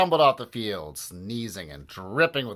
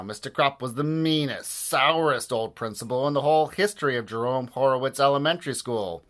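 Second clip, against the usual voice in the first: ordinary delivery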